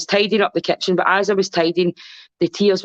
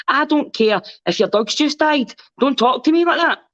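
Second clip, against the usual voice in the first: indignant rebuke; 7 semitones higher than usual. Retold or imitated. She tells off a friend who answered rudely, indignant and high. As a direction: scold them for a rude tone, in mock outrage. Imagined rebuke to a rude caller.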